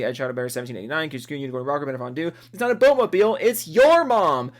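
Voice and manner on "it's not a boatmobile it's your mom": high, loud yell, drawing out the last words; loud, high-pitched mock outburst; joke yell. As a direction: belt it out like a silly punchline. Yells out a joke name from list.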